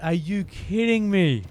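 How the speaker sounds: loud, high, slow exasperation; shouting. Real, not acted. Gamer after apologising for shouting, still shouting in disbelief. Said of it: "I'm sorry for shouting at you".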